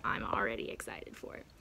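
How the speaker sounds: excited voiceless whisper. Real, not acted. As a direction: whisper it with quiet delight. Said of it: "sorry for the whisper voice".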